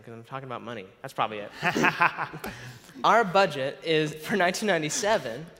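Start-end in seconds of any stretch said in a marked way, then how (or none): 1.5-3.0 loud chuckle at one's own joke; real, self-deprecating laugh. Real, not acted. Laughs at own stuttering remark.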